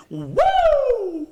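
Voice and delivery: high victorious woo; celebratory whoop. Real, not acted. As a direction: whoop like a cheering fan. Celebrating surviving the pandemic.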